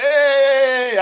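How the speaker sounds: high, loud cheer; the whole hall cheering the new visa; mock cheering crowd. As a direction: shout it like a happy crowd. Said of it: "Everybody was like".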